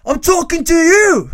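high, loud, drawn-out shout back; hammed-up angry British comeback; getting heated. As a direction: yell it right back at him. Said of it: "Jeg begynner å bli hissig".

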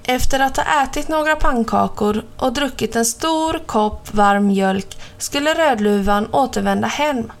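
ordinary delivery

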